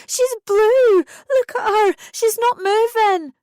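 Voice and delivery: very high, loud panicked scream. A narrator's reading. Mother screaming that her baby is blue, panicked. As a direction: scream for help. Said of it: "so I started screaming".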